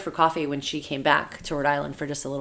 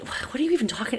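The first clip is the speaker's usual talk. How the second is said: incredulous; 8 semitones higher, 3 dB quieter than usual. Retold or imitated. Mimicking the family's delusion.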